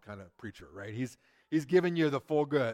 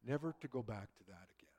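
hushed, breathy whisper after a long pause; dramatic whisper; 4 semitones lower, 10 dB quieter than usual. Acted. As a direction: let it fall to a whisper, like closing a chapter. Dramatic pause in Jesus' departure.

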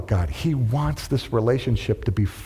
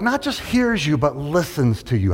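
ordinary talk

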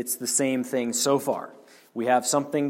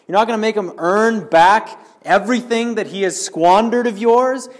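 higher and louder aggrieved rant; indignant older brother; 6 semitones higher, 5 dB louder than usual. Acted. Resentful son berating his father, hot with indignation. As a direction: protest the unfairness, building outrage. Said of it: "This is the attitude of the self-righteous Pharisees".

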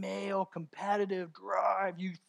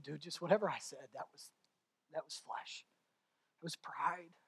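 quiet confession; 3 semitones lower, 10 dB quieter than usual. Retold or imitated. Quiet apology to son.

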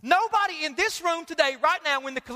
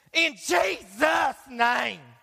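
very slow booming mock televangelist; TV preacher parody; much slower than usual. TV preacher closing his money plea, stretched and booming.

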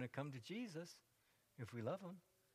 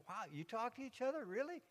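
much higher, mock astonished question; sarcastic wide-eyed disbelief; mock surprise; 7 semitones higher than usual. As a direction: play a gawking friend who can't believe it.

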